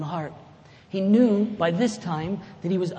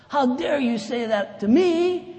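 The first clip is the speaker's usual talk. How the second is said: high, loud, slow indignant retort; offended retort; 8 semitones higher, 7 dB louder, slower than usual. Retold or imitated. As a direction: fire it back, wounded and indignant. Other spouse retorts offended.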